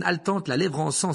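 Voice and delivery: plain reading voice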